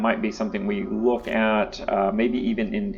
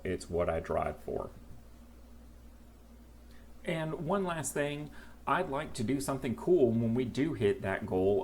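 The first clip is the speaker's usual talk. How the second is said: softer, heartfelt outro-style read; sincere channel plea; 11 dB quieter than usual. Acted. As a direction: talk to viewers softly and earnestly. Sincere subscribe plea to viewers.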